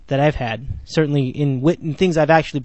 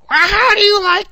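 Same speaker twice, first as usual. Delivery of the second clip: mocking a radio host's squeaky voice, pitched way up and louder; squealy Andy Gresh voice; 7 dB louder than usual. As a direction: squeal it out like a whiny host. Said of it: "he's got that really squealy voice".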